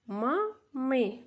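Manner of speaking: "Ма, ми" said as two separate syllables; teacher splitting a word into syllables; split into syllables. Shown as a demonstration. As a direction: pause between "Ма" and "ми". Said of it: "Перший склад ма".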